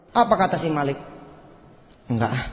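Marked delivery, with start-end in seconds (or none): 2.1-2.4 low, understated acted brush-off; reluctant refusal. Malik declines his friend's job, acted reluctant refusal. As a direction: turn it down lazily, voice dropped. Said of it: "lagi males".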